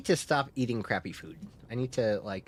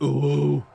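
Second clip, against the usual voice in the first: woo call; 8 dB louder than usual. Shown as a demonstration. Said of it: "Now we're here, like".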